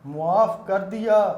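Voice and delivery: loud, slow repeated verse; naat recitation. Acted. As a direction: repeat the line on request, declaimed. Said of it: "مکرر، مکرر".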